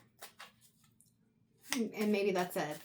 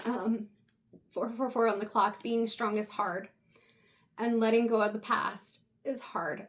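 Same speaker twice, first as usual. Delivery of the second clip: ordinary talk, nothing marked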